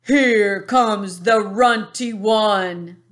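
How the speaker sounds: loud, slow, menacing giant voice; booming giant. A narrator's reading. Bully giant mocking the BFG, loud and slow. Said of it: "boomed the".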